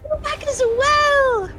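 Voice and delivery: excited. Acted. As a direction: greet them with delight. Greeting returning friend.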